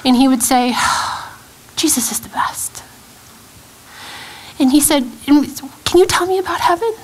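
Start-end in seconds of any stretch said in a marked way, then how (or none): 1.7-2.8 soft, breath-only bedtime whisper; tired child whispering. Retold or imitated. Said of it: "and of course he's so tired". 5.3-6.9 put-on higher-pitched little boy's question; young child asking. Retold question of her young son.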